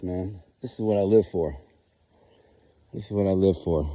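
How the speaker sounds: usual speaking voice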